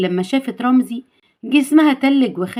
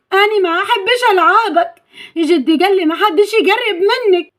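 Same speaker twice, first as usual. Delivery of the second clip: put-on high-pitched girlish refusal; small high voice pushing him away; acted childlike protest; childlike heroine, uneasy; 7 semitones higher than usual.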